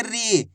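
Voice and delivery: "री" with the ई stretched; long drawn-out ī. Shown as a demonstration. Teacher giving learners an example, "री" drawn out. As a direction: hold the vowel of "री" long. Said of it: "खींच खींच के उच्चारण करना है".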